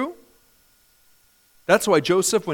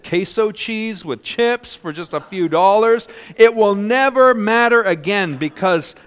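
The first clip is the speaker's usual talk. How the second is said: rising preacher's crescendo, louder and higher; preaching louder; 4 semitones higher, 7 dB louder than usual. Real, not acted. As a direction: build it up to a loud peak. Sermon building to climax.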